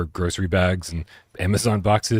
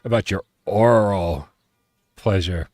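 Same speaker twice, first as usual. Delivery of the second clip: show host intro; 2 semitones higher than usual. Acted. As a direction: kick off the stream like an announcer. Opening the live show.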